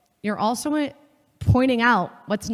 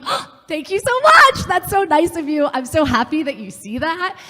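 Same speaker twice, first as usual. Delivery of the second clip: gushing thanks; 8 semitones higher, 3 dB louder than usual. Retold or imitated. To her supervisor, flattered: high, loud, gushing thanks settling down. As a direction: swoon over the compliment.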